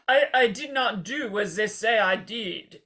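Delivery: slow, put-on French accent; hammed-up French accent denial; mock French accent. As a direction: imitate a Frenchman, haltingly slow. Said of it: "French accents".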